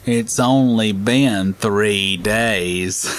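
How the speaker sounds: very slow, dragged-out complaint; odd slow caller. Retold or imitated. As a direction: stretch every word like it's urgent. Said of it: "do crazy sounding stuff".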